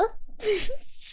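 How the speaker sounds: a sudden squeaky giggle, soft; high squeaky laugh. Real, not acted. Laughing at her own worry.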